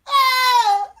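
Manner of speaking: high wordless wail; high-pitched mock squeal; mock shout. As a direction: imitate her crying out a garbled answer. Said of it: "just shout it out".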